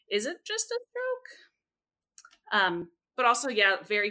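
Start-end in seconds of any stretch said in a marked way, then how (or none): 0.1-1.3 high questioning rise; doubtful question. Real, not acted. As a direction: question it pointedly.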